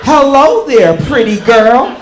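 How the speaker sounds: put-on high, loud, slow gushing over a baby; old lady cooing. Old lady with Parkinson's cooing over the baby. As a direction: gush like a sweet old lady. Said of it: "She was like".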